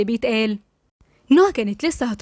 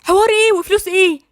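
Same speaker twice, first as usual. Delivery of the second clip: high-pitched, raised-voice outrage; shocked, angry, loud; 7 semitones higher, 3 dB louder than usual. A narrator's reading. Heidi hearing Gabal's excuse for marrying his cousin, loud and furious. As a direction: explode at him in indignant disbelief. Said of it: "هايدي بصدمة وبعصبية وبصوت عالي".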